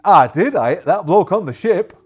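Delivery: loud, proud answer; lad's reply. Acted. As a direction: shout it back proudly. Soldier answering the captain.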